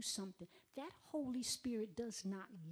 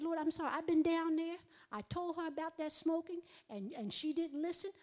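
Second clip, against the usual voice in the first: louder and higher, arguing back at God; exasperated protest; 7 semitones higher, 6 dB louder than usual. Retold or imitated. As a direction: push back hard, fed up.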